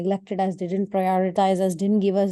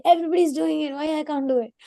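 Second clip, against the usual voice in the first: put-on pitched well up, pleading kid; mock childish excuse; 8 semitones higher, faster than usual. Child asking the elders why he can't, high mock whine. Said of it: "we say".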